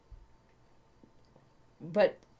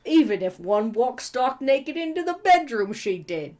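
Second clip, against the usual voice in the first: higher, louder muddled Cockney; uncertain put-on accent; 4 semitones higher, 5 dB louder than usual. A narrator's reading. Nox jokes about the other men in a muddled Cockney accent. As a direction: exaggerate a wandering Cockney accent. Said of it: "I don't know what accent this is".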